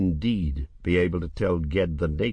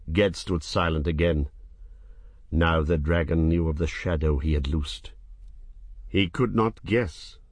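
plain reading voice

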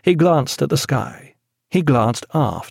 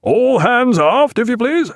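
high, drawn-out deck call; captain hailing all hands; calling an order; 12 semitones higher, 4 dB louder than usual. A narrator's reading. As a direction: sing out the order slowly, high and loud. Jack said.

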